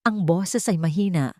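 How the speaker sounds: ordinary delivery, nothing marked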